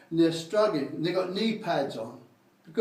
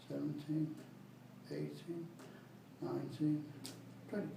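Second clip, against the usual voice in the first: fading count; 4 semitones lower, 9 dB quieter, slower than usual. Shown as a demonstration. Trainer counts up to twenty, fading softly. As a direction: count reps, sinking much softer. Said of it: "fifteen, twenty".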